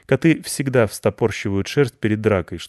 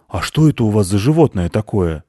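suspicious hunter; 5 dB louder than usual. Acted. Hunter questions children.

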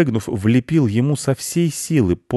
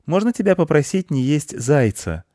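put-on high-pitched child's request; boy politely begging a favour; pleading boy; 8 semitones higher than usual. A narrator's reading. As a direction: ask it up high like a little boy.

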